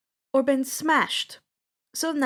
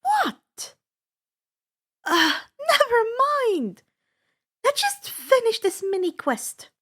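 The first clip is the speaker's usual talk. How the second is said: flustered Annabeth; 11 semitones higher, 3 dB louder than usual. A narrator's reading. Percy teases Annabeth, flustered.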